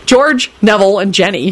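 ordinary delivery

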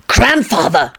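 ordinary delivery

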